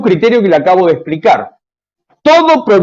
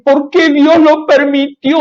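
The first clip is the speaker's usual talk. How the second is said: mock complaint; 9 semitones higher, slower than usual. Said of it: "es decir".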